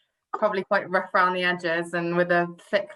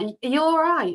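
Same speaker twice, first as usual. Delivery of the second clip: raised-pitch customer check-in; quoted question in a higher voice; imitated customer; 7 semitones higher than usual. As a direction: play a bar customer asking, voice lifted. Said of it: "people like".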